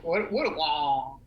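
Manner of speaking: slow, stretched Jamaican greeting; Jamaican accent. Shown as a demonstration. Asking a friend if that's it, slow Jamaican attempt. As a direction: have a go at Jamaican, drawing it out. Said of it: "Is that it?".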